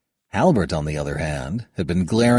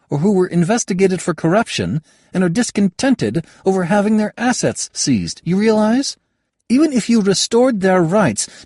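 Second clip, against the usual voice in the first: higher voiced young woman, pressing her point; young woman arguing; 9 semitones higher, 4 dB louder than usual. Kayada lectures Halbert on the nobles, higher and firmer. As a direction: lay out the case sharply, rising as she goes.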